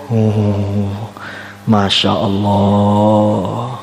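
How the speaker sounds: very slow, low, half-sung exclamation; drawn-out awe. Retold or imitated. Preacher marvelling at a beauty that freezes the body, drawn-out awe. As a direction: let the wonder linger on long vowels. Marvelling at the frozen onlooker's vision.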